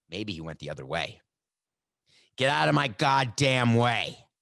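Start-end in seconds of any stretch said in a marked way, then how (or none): 2.4-4.3 slow, punched-out angry order; emphatic demand. Acted. Player ordering security out of his way, emphatic. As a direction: hit every word hard and slowly. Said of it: "That's emphatic".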